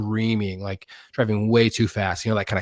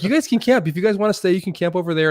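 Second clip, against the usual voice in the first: high-pitched, raised retold voice; backtracking offer; 9 semitones higher, 4 dB louder, faster than usual. Claim holder letting campers stay after all, imitated in high voice. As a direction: imitate a man hurriedly conceding ground.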